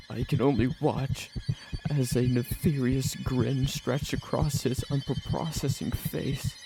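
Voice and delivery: usual speaking voice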